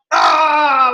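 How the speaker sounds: loud high ah of annoyance; exasperated shout. Retold or imitated. Annoyed friend snapping at a preachy question, loud shout. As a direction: cry out, fed up. Upset person reacting.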